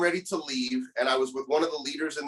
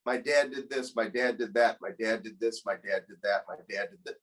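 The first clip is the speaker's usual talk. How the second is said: each my dad did this sinking lower and softer; girl fading off as she lists her dad's wrongs, lower and lower; fading lament; 6 semitones lower, 3 dB quieter than usual. Retold or imitated. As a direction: let the list sink into near silence. Girl listing father's wrongs.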